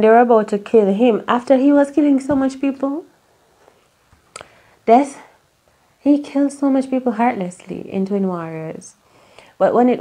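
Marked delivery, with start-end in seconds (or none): none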